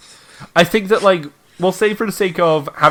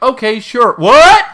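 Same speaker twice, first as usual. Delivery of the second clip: yelling; 9 semitones higher, 10 dB louder, slower than usual. Retold or imitated. Deaf character yelling "what.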